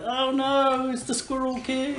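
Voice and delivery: high-pitched mock alarm, falling away; panicked townsfolk. Game master plays fleeing townsfolk, high mock panic. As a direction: wail in pretend dread. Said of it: "They're running around like".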